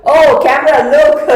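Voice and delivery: a hammed-up rant that breaks off at the camera; loud, high mock fury; mock outburst. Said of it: "but it's like".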